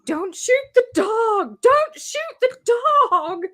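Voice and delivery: high-pitched, drawn-out mock yell; yelling at screen. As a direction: re-enact yelling at the movie, high and slow. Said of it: "I was yelling at the screen".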